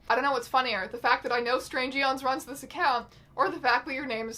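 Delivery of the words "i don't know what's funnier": usual speaking voice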